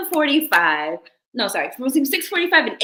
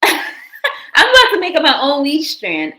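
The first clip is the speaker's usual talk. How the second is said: laughing; 6 semitones higher, 5 dB louder than usual. Real, not acted. Laughing at the made-up strain.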